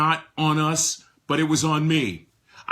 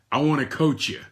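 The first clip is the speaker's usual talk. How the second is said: usual speaking voice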